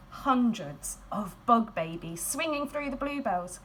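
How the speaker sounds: usual speaking voice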